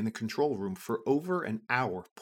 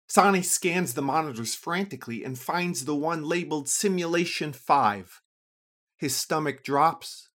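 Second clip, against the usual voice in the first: usual speaking voice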